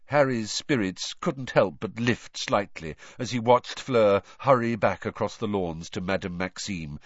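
ordinary delivery

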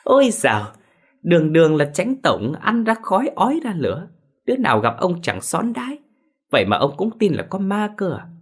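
a high, mocking wife's voice; scornful wife. Wife scoffing at her husband's ghost story, high and mocking. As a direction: needle him like a sharp-tongued wife.

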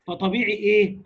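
ordinary delivery

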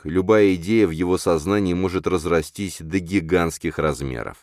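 ordinary delivery

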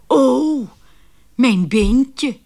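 a mild mock wail of loss; dismayed cry. Gentleman discovering his lucky bone is gone, dismayed cry. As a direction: lightly cry out over the lost charm. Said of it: "riep hij".

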